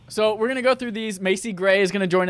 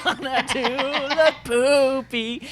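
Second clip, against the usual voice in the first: high, slow, lisping sung voice; [name] impression; 7 semitones higher than usual. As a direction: croon it with a lateral lisp. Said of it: "I have to get my [name]".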